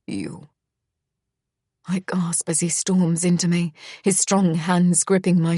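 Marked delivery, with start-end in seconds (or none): 0.1-0.5 very low, soft single word; low murmur. A narrator's reading. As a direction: drop it low and quiet. Misha's low answer.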